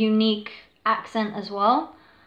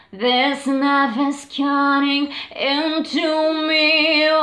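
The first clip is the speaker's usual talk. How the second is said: slow, strained scream-singing, higher; 4 semitones higher, 4 dB louder, much slower than usual. Shown as a demonstration. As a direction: belt it out like it hurts. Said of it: "She does the shouting, aggressive".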